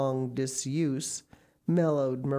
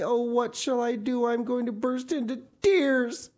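high, loud, near tears; despairing child; 11 semitones higher, 5 dB louder than usual. A narrator's reading. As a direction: pour out the heartbreak breathlessly. Anne despairs, about to cry.

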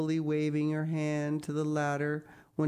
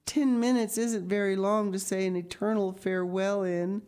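put-on higher, plaintive girl's voice; tearful; 6 semitones higher, 4 dB louder than usual. A narrator's reading. Anne faces an eternal farewell, mildly tearful plea. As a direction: plead it with a touch of tears. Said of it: "said Anne tearfully".

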